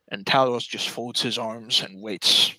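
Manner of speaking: ordinary talk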